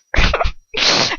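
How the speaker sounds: high, breathy burst of laughter; real laugh. Real, not acted. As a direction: crack up at the scene. Reader breaks up laughing.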